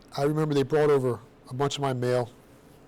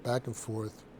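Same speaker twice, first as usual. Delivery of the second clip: trailing off; 4 semitones lower, 8 dB quieter, much slower than usual. Shown as a demonstration. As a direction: back and forth dropped lower and much softer. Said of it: "repeated phrase, second fades".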